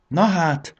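high, loud gasp of wonder; marvelling, pitch jumping up; amazed. A narrator's reading. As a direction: exclaim in astonishment. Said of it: "ámuldozott Jakab".

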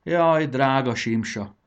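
ordinary delivery, nothing marked

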